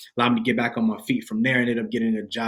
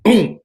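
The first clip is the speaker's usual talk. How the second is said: sudden loud, high "boom"; door-slam boom; 8 semitones higher, 11 dB louder than usual. Retold or imitated. Man telling how he slammed the door, loud imitation.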